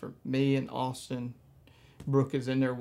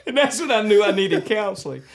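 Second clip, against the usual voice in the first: high and loud, still laughing; 7 semitones higher, 10 dB louder than usual. Real, not acted. Joking to the host that he needed counseling, laughing. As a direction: wrap it up while cracking up. Wrapping up horsefly story.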